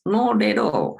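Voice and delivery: high-pitched, emphatic no; emphatic denial. Acted. Teacher answering an imagined student's accent question, emphatic denial.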